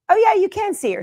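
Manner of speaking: high, bright burst of relief; pleased the dog finally shows up; delighted. Real, not acted. Owner relieved the camera finally shows her dog, excited high voice.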